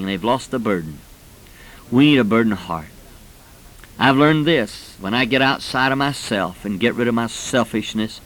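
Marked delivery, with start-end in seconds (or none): none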